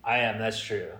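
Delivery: ordinary talk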